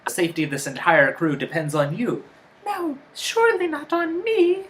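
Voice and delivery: high, loud mock melodrama; acted episode dialogue. Co-host mimicking the episode's crew, mock melodrama. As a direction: ham it up, voice climbing high.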